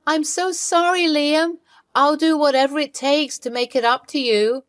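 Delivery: desperate wife pleading on the phone. Acted. Wife begs husband for forgiveness.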